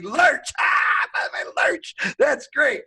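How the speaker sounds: high, loud, cracking up at a nickname; helpless laughing. Retold or imitated. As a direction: re-enact delirious hilarity. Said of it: "I'm laughing. I'm like".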